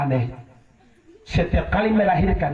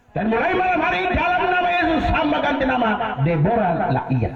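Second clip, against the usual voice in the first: raised, proclaiming prayer voice; solemn prayer; 7 semitones higher than usual. Retold or imitated. Pastor praying over the crying baby, proclaiming the new name. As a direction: declare the new name with authority. Pastor prays renaming the child.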